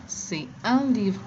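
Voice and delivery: slow, careful C'est un livre; slow model answer. Shown as a demonstration. Teacher models the answer for students slowly. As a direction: say it slowly for learners.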